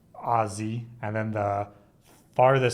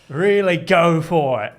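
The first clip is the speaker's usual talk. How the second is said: imitating Steve Irwin; 5 semitones higher, 9 dB louder, slower than usual. Shown as a demonstration. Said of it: "extend the vowels really long".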